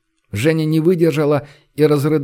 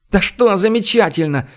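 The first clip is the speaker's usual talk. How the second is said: high-pitched indignant burst; angry outburst; 6 semitones higher than usual. A narrator's reading. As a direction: explode at the other person. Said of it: "взорвалась Женя". (взорваться, высоко и возмущённо)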